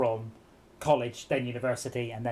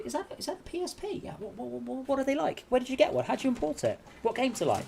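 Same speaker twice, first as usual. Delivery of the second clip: much higher, breathless gushing questions; eager candidate; 9 semitones higher than usual. Retold or imitated. A candidate spotting the PSP on the desk, high and gushing. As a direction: gush like a fan who spotted the console. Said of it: "They're like".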